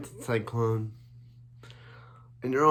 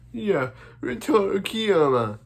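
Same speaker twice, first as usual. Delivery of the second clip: high put-on character voice, louder; Rintaro Kiyama voice; 9 semitones higher, 6 dB louder than usual. Fan showing off his favourite character voice, high and loud. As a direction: pitch it way up into a cartoon character voice. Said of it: "I love doing my Rintaro voice".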